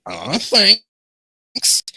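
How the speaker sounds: high, gushing thanks; high-pitched, hammed-up gratitude; put-on stranger's voice. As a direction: squeak out a thank-you in character.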